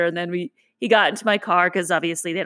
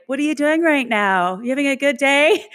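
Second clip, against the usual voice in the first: teasing; 8 semitones higher than usual. Retold or imitated. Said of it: "I'd be like".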